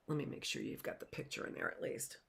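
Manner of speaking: soft, fast whispered aside. Real, not acted. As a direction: whisper it to yourself while fiddling with the book. Aside while showing the book.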